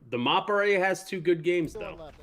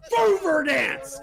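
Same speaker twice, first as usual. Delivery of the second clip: frat bro; 10 semitones higher, 5 dB louder, much slower than usual. Real, not acted. Hyped celebration continues.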